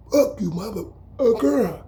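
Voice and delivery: high, slow sneering curse; defiant thrower voice. Retold or imitated. The thrower curses him again, high and slow sneering. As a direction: drag out the insult up high.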